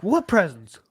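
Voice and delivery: imitating a condescending retort. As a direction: throw it back as a mock-haughty question. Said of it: "he said it so condescendingly".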